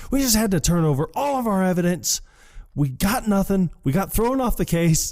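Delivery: high-pitched, exasperated mock rant; retold angry rant. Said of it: "tired of you yelling".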